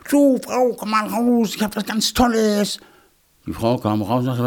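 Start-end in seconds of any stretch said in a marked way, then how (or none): 0.1-2.8 high, singsong call to someone indoors. A narrator's reading. Hedgehog calls his wife out of the house, calling. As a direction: call her out, pitched high. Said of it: "rief seine Frau".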